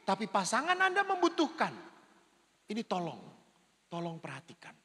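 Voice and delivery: usual speaking voice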